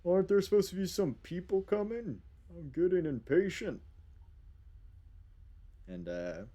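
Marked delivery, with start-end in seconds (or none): 0.0-3.8 high-pitched whiny bear voice. Acted. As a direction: complain impatiently in a higher creature voice.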